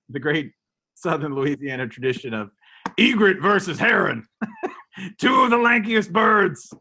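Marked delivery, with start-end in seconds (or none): 3.0-6.7 higher, hammed-up announcer voice; bird-fight hype man. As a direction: shout it like a swamp showman.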